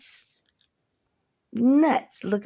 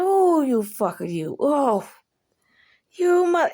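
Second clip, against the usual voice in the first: raised, exasperated retold cursing; indignant splutter, cut short; mock outrage; 5 semitones higher, 4 dB louder than usual. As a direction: cuss out the prankster in mock fury. Retold cursing at laughing partner.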